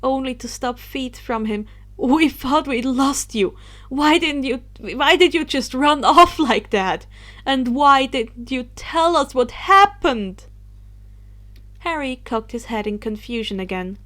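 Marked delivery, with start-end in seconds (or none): 2.0-10.4 anxious questioning. Acted. Remus anxiously questioning Harry.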